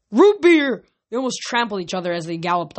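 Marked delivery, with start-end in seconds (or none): 0.1-0.8 high, loud cheer; gleeful holler; excited shout. A narrator's reading. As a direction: whoop it like a thirsty crowd stampeding off. Centaurs stampede off for root beer.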